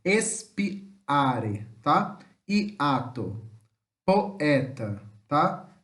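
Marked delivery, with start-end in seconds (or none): 0.0-1.7 "Espiare" with a clear gap between espi and are; separated hiatus. Shown as a demonstration. Teacher proving it isn't said together, separated vowels. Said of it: "pronuncia separado". 2.5-5.0 "Hiato" and "poeta" split between the two vowels, lower; separated hiatus. Shown as a demonstration. Teacher speaking pausedly so students see the split, separated vowels. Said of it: "Eu falei pausadamente pra vocês verem".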